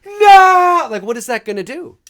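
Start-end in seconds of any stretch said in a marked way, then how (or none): 0.1-0.9 loud, high shout; mock heroic scream. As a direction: yell it like a superpower. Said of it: "you're gonna go".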